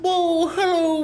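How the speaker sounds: high, drawn-out mock British greeting; botched British accent, stretched out high; bad British accent. Host fumbling an impression of a TV interviewer, bad British accent. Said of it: "can't do a British accent".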